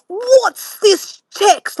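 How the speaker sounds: high, very slow, snippy imitation of the dad; mock the dad's rude demand, drawn out; drawn-out high mocking of a rude question; bad attitude. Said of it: "Like, really bad attitude".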